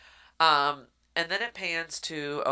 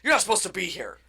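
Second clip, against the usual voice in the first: higher, louder mock scolding; mock stern; 5 semitones higher, 6 dB louder, much faster than usual. King pretending to scold the girl, mock angry. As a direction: play at being mad. Tries to be mean and mad.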